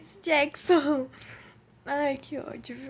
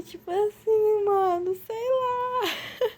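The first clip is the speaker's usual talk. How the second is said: voz embargada entre riso e choro, arrastada; rindo e chorando; 8 semitones higher, much slower than usual. Real, not acted. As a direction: fala rindo e quase chorando ao mesmo tempo. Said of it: "eu sei que eu tô rindo". (riso choroso de verdade)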